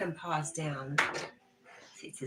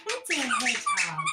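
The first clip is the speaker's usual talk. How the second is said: much higher and louder, rising on "hedgehog"; 11 semitones higher, 8 dB louder than usual. Real, not acted. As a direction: squeal it at the dog. Playful teasing of dog.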